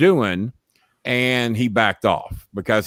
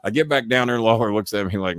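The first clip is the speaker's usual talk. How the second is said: ordinary talk, nothing marked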